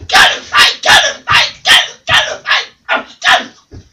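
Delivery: loud raspy hissed parrot cries; harsh unvoiced parrot yelling; hollering parrot. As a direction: rasp it like a bird siccing the dog. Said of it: "The bird just hollered".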